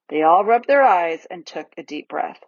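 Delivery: usual speaking voice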